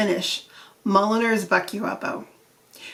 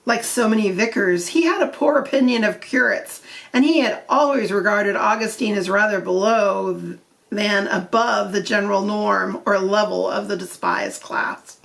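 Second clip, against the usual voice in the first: ordinary delivery, nothing marked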